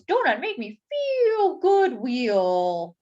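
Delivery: a lightly voiced goofy donut. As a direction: drawl it out goofy and slow. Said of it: "This one says".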